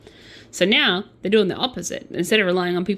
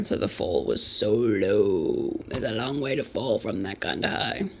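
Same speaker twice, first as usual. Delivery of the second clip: dropped low; 12 semitones lower, 5 dB quieter than usual. Shown as a demonstration. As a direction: sink the voice right down. Acting out how low she fell.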